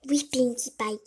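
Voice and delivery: normal talking voice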